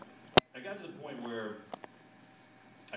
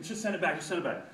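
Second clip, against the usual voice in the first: raised, louder hurried order; mock urgency; 5 semitones higher, 5 dB louder than usual. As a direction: urge the engineer on, playing it frantic. Acting out urgent order to engineer.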